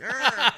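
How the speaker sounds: loud high grunt while laughing; hammed-up strained "Arr!" reaching for the ball; comic effortful cry; mock old man grunt. Old man wiggle under ball.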